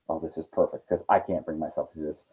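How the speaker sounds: a quiet aside of relief; relieved aside. Acted. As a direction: sigh it out in relief. General relieved to pass task.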